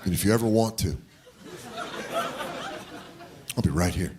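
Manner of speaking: low, soft, gentle fatherly voice; tender, hushed sincerity; dad-like concern. Retold or imitated. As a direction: imitate a caring dad, softly, with long pauses. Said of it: "I'll just dad on this kid".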